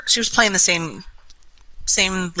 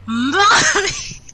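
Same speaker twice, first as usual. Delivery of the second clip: put-on high, giggly child's cry of Mommy; giggling child calling for mom; drunk little girl calling; 13 semitones higher than usual. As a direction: call out like a tipsy kid in bed. Drunk kid calling her mom.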